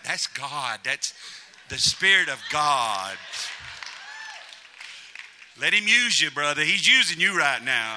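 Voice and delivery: hammed-up churchwoman urging her brother on; mock excited women. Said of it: "They're like".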